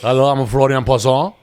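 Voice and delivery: mock French accent on Florian Poisson; hammed-up French greeting. Shown as a demonstration. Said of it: "that's very French".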